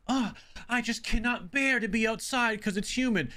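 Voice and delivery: high mocking whine of someone who hates heat; mock the fussy type who can't stand humidity; a put-upon voice lifted high in mockery; whiny complainer. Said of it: "the type of person where, like".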